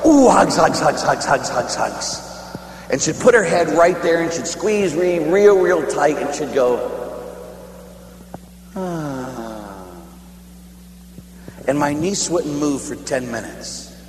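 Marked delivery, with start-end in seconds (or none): 0.1-2.2 affectionate hugs. Retold or imitated. Squeezing his little niece. 8.7-9.8 soft, low contented sigh; contented child's sigh. Retold or imitated. Niece sighs in his arms.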